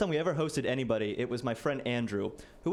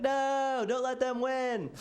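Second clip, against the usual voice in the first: high, drawn-out mock battle cry; hammed-up high defiance; defiant mock hero; 11 semitones higher, much slower than usual. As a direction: squeak it out like a doomed rebel. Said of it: "And I was like".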